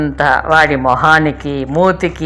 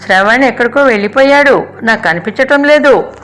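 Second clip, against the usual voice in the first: higher and louder, calling in a panic; anxious shouting; 6 semitones higher, 3 dB louder than usual. A narrator's reading. Girl alarmed her baby cousin is missing, shouting for mother and aunt. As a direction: shout for the grown-ups while running down. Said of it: "అరుచుకుంటూ".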